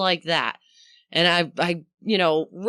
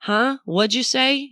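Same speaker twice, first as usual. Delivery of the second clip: someone mishearing; 7 semitones higher than usual. Retold or imitated. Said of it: "as if someone says".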